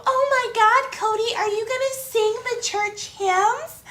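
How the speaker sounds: imitating a little old lady, high and excited; high-pitched mock churchgoer gushing. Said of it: "these little old ladies who walk up and go".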